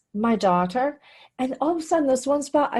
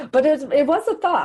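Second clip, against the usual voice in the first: louder, with a laugh on the words; laughing admission; 4 semitones higher, 5 dB louder than usual. Real, not acted. A wife confessing she wanted to hit her husband, laughing.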